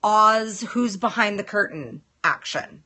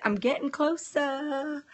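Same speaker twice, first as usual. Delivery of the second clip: slow, softer British accent, higher pitched; 5 semitones higher, 6 dB quieter, slower than usual. Shown as a demonstration. Telling Barry the accent is improving, British accent. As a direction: stretch it out in a put-on British accent. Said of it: "Good Brit accent".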